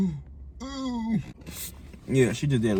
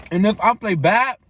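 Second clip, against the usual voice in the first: talking through a mouthful; words round a bite of popcorn; mouth full; 6 semitones higher, 4 dB louder than usual. Real, not acted. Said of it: "Let me stop talking my mouth full".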